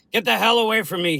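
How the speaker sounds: loud, high-pitched and fast; angry roar. A narrator's reading. Waking patient to hazmat crew, roaring. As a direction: roar it out in alarm to drive them off. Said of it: "he roared".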